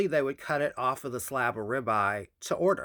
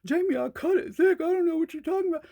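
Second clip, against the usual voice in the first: mock husband voice, much higher and fast at the end; imitating husband; faster than usual. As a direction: play the husband brushing her off. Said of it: "He was like".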